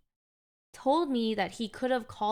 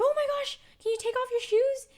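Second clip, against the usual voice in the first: much higher, louder horrified squeal; shocked squeal; 4 dB louder than usual. Retold or imitated. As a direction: gasp it out, appalled at the muddy shoes. Horrified at muddy stairs.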